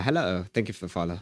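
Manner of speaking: ordinary talk, nothing marked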